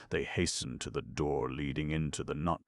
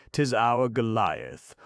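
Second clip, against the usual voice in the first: teasing; 7 semitones higher, 6 dB louder, slower than usual. A narrator's reading. Aubry mocking Herman.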